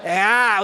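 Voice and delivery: loud laugh; preacher laughing out loud at his own joke; real burst of laughter. Real, not acted.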